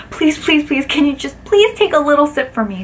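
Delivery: high, pleading wheedle; mock begging. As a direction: beg her sweetly to take a sip. Begging waitress to drink.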